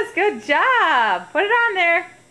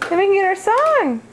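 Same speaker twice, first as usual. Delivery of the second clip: put-on bright coaxing toddler talk; reading to a toddler; 2 semitones higher, 3 dB quieter than usual. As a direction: coax a little child gently.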